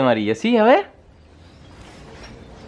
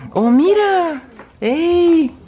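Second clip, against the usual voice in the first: much higher, louder burst of delight; delighted exclamation; 10 semitones higher, 4 dB louder, much slower than usual. Real, not acted. Visitor delighted by Luisito's coloring, excited high cry. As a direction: gush over the drawing with real joy.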